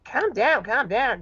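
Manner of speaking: mildly exasperated calming; protesting. Acted. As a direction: fend off an overwrought questioner. Grabbed witness fends him off.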